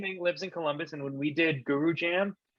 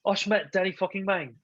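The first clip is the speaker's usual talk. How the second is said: put-on Ephraim accent; 5 semitones higher, faster than usual. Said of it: "That's my Ephraim accent".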